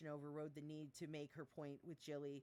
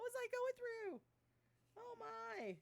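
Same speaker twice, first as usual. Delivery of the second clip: high squeal; slower than usual. Real, not acted. Shocked at own writing.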